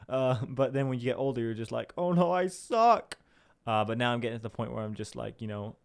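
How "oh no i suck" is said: high, slow mock wail; mock dismay. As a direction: lament it in playful despair. Acts out older self's self-doubt.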